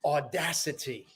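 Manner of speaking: slow, emphatic spoken-word delivery, high attacks falling away; dramatic poem recital. Acted. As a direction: declaim each line, pausing before the last words. Recites own motivational poem.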